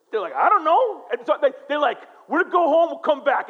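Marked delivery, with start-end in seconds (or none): 0.3-1.1 high, louder mock helpless answer; stumped student. 2.3-3.5 high, louder mock flustered excuse; flustered retreat.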